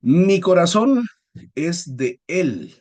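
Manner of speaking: loud, slow and drawn out; teacher reads the example sentence, slow and theatrical; dramatic slow declaration. Shown as a demonstration. Teacher to class, reading a love line slowly and loudly. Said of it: "qué feo se escuchó eso".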